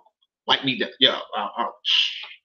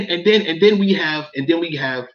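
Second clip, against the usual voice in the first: normal talking voice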